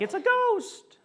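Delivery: very high, hammed-up panicked cry; mock freak-out. Disciples in the boat spotting Jesus on the water, mock freak-out. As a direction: squeal it like a comically spooked disciple. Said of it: "the disciples are freaking out".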